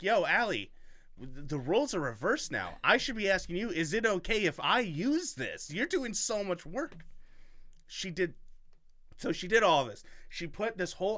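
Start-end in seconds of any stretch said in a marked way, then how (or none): 0.0-7.0 mildly hammed-up protest, higher and louder; retold friendly protest. Host quoting himself to the hard-working writer, retold protest. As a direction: reenact an emphatic, incredulous objection.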